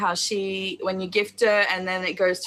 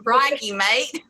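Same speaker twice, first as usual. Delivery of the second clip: slow, put-on Australian accent. Shown as a demonstration. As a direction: give your best stretched-out Aussie accent. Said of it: "give us your best Australian accent".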